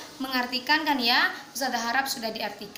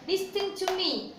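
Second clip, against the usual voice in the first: higher, slow, commanding; firm teacher command; 6 semitones higher, slower than usual. Shown as a demonstration. Teacher calling class to attention, slow and firm. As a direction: demand the class listen.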